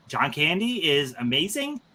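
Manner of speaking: ordinary talk, nothing marked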